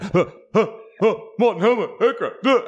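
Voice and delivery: high, breathy nervous stammer with laughs; nervous comedian. Retold or imitated. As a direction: imitate a jittery comic stalling. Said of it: "He went".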